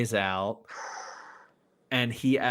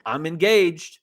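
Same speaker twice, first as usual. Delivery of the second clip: loud, higher-pitched announcement; mock-brash, raised-voice quote; storming in; 6 semitones higher, 6 dB louder than usual. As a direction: blurt it out loudly, acting out her entrance. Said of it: "storms in, and she's like".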